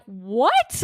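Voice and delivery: stunned, rising "what?"; shocked disbelief. Retold or imitated. Shocked reaction to covid news, retold.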